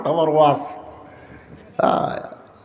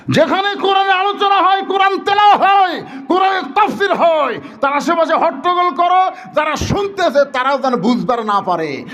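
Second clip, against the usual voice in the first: high, ringing chanted cry; impassioned preaching; 12 semitones higher than usual. Real, not acted. Preacher warns of those disrupting Quran gatherings, impassioned preaching. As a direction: drive each phrase at the crowd, high.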